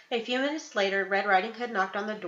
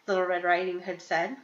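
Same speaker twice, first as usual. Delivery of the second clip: ordinary delivery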